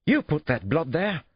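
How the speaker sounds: high, panicked English yell; yelled accusation. A narrator's reading. Cornered suspect accuses detective of planting blood, yelling. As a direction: lose your cool and yell the accusation. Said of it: "he yelled at me".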